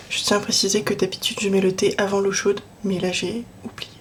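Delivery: hushed guilty aside; whispered confession. Real, not acted.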